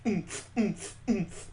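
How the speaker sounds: plain reading voice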